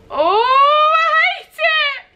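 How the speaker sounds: very high, loud drawn-out wail; long, high-pitched cry of dread; spooked squeal. Real, not acted. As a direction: squeal it out in a jumpy fright. Spooked alone in the room.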